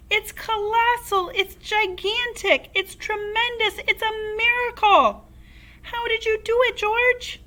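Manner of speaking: dance about crowing with delight; excited shout. A narrator's reading. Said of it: "Mister Cranky shouted, dancing around".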